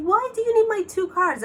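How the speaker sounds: high-pitched, indignant challenge; indignant questioning. Retold or imitated. Caller challenging scammers over two cards, indignant.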